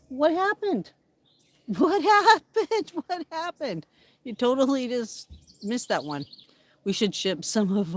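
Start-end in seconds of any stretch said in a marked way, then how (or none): 0.1-3.9 high, laughing what happened; laughing high as the bird misses; amused disbelief. Real, not acted. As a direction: squeal it in surprise with a laugh.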